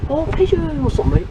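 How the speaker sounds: high delighted cry at a pigeon; sudden happy squeal; excited. Real, not acted.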